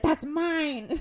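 high possessive snap; bratty kid. Retold or imitated. Sisters fighting over clothes, high bratty claim. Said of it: "And it was like".

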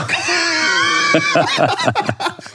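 drawn-out squealing laughter; high laugh. Real, not acted. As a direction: burst into long, high laughter. Laughing at his own confession.

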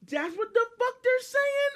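high, loud disbelief; acted-out baffled outburst; incredulous outburst. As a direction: blurt it out in high-pitched astonishment. Acting out disbelief at misheard question.

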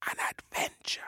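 slow, secretive whisper; long shush, then hushed whispering; hushing whisper. Acted. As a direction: whisper it like someone afraid of being given away. Warrior hushes the birds again.

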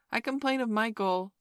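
put-on high, loud childish complaint; instant tattling. A narrator's reading. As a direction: pipe up at once to tell on him. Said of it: "said Curly instantly".

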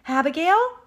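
high, loud rising call; yelling. A narrator's reading. Pig searches at the oak tree, yelling. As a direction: yell the name as a question. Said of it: "he yelled".

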